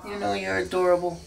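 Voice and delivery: quick high coo to a pet; cooing cat talk. Real, not acted. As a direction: gush over the cat.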